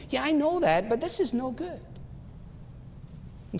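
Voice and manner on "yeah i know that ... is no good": high, affronted voice of a proud commander; mock-outraged general; indignant Naaman. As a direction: play the insulted big shot, pitched up. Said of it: "So he was enraged".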